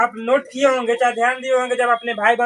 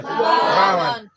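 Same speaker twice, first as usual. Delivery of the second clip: drawn-out answer; 5 semitones lower, much slower than usual. Shown as a demonstration. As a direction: draw it out so the class repeats it. Teacher drawing out the answer.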